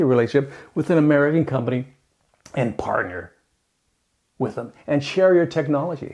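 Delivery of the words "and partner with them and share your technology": ordinary talk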